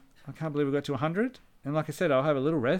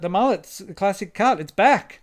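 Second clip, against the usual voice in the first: excited; 6 semitones higher, 7 dB louder than usual. Real, not acted. Excited praise of the mullet.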